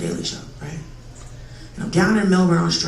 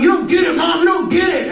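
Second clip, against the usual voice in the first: brother yelling; 8 semitones higher than usual. Retold or imitated. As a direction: shout back at mom. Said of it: "my brother going".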